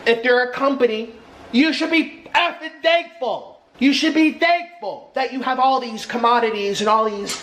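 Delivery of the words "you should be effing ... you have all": angry. Real, not acted. Angry emphasis.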